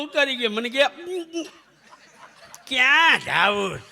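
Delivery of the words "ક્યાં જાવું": conductor's slow, loud question; gruff conductor. Retold or imitated. Bus conductor asks the traveller where he's going, slow and loud.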